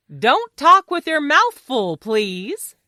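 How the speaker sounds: high, emphatic correction, easing on please; firm admonishment. Acted. Fox corrects Mister Smacklips at the table, firm admonishment. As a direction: admonish them, then soften at the end.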